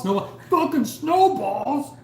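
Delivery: high, slow, drawn-out shout through laughter; hammed-up outburst of snack-cake frustration; crazed mock yell. Retelling the zombie film's snowballs letdown, going comically crazy. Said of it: "He's all going crazy and shit".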